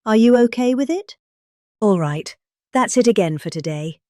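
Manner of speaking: normal talking voice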